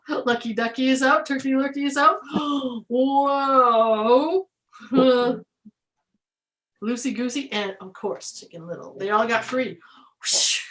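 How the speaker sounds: plain reading voice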